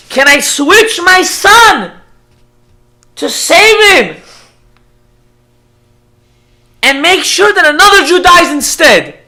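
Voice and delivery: loud, high, drawn-out begging; impassioned plea. Retold or imitated. Father begs the rabbi to save his son, impassioned plea. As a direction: cry out the question as a desperate father.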